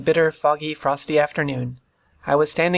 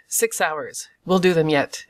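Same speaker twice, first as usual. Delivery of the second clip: ordinary delivery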